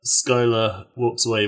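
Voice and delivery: ordinary delivery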